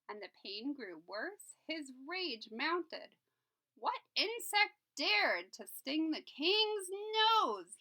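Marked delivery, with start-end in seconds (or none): 3.8-7.8 slow, high, loud royal roar; angry shout. Acted. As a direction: bellow it as an outraged king. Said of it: "he shouted".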